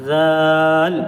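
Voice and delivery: رال held long and clear; letter demo. Shown as a demonstration. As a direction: sound out رال slowly for the class. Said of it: "زبان کی نوک ... کنارے پر لگانا ہے".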